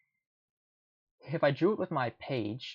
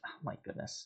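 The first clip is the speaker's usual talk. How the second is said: whispered; 12 dB quieter than usual. Real, not acted. Exasperated aside during the game.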